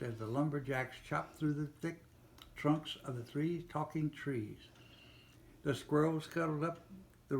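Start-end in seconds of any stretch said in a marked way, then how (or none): none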